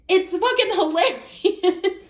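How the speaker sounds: high, slow words dissolving into a laugh. Real, not acted. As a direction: crack up at the story. Laughing at the comeback.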